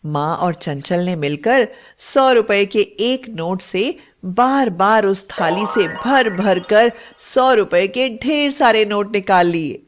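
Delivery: usual speaking voice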